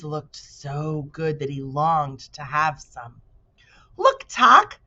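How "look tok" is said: put-on high, loud boyish exclaim; eager boy crying out; excited cry. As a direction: call out in delight. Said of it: "he cried".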